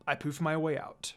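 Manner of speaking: plain reading voice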